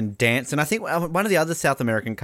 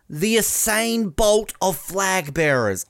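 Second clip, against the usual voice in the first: high, slow, drawn-out commentary line; commentator Bruce; 7 semitones higher, much slower than usual. Retold or imitated. Quoting the commentator on the flag bearer, mock commentary. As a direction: stretch it out like a grand commentary clanger. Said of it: "Bruce's commentary of".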